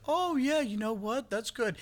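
mock impressed politician. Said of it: "listen and be like".

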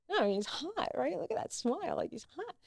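high, excited confiding voice; retold hushed gushing. Gushing confidentially about crush to co-star.

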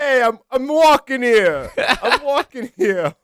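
hammed-up New York cabbie accent. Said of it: "True New York over here".

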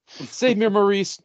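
high, hammed-up damsel plea; a mock damsel calling for help. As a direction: cry out for rescue, overdone. Mocking victim calling for help.

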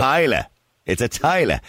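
"Tyler" for toilet, final T dropped; mock local pronunciation, toilet as "Tyler"; unfinished toilet. Shown as a demonstration. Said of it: "some people cannot finish off words".

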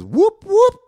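high, loud imitated siren whoop; loud, high siren noise; police siren imitation. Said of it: "Next thing we heard is".

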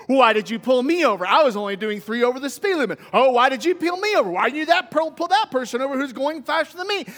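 mock indignant complaint; upset driver. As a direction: whine at being singled out, hammed-up. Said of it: "No, we get upset".

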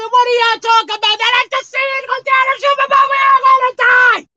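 high, loud frantic disciples; panicked shout. Acted. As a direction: shout fast that you're all going to die.

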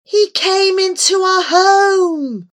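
high, loud, drawn-out howl of grievance. A narrator's reading. Remus accusing through his violin, long high howl. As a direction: howl it slowly in jealous hurt. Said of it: "Remus howled through the notes".

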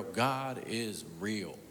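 God is real, each word slow and weighty; slow emphatic declaration. Real, not acted. Preacher tells doubters in the congregation, slow and emphatic. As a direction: lean on it slowly, dropped low and soft.

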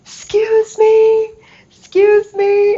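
high, loud, drawn-out call. Retold or imitated. Chasing the lady down the street, slow loud calling out. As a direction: call after someone walking away down the street.

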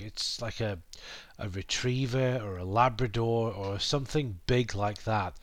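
usual speaking voice

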